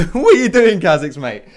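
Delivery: loud, high, incredulous question through laughter; laughing taunt. Real, not acted. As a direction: jeer at a beaten rival, laughing out loud. Laughing taunt at a beaten opponent.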